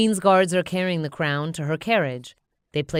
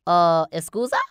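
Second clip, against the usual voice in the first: a hint of a puzzled minion; 6 dB louder than usual. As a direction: ask it like a confused minion. Bob speaks Minionese.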